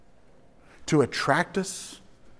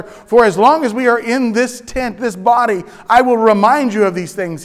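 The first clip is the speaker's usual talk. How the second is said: loud, raised preaching voice; quoted scripture; 9 semitones higher, 9 dB louder than usual. Acted. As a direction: proclaim it like scripture. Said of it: "he says".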